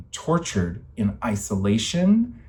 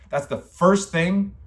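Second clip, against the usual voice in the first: slow and higher, stunned at the words; disbelieving; 9 semitones higher, slower than usual. Real, not acted. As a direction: drag it out in flat disbelief. Repeats the caller's reply in disbelief.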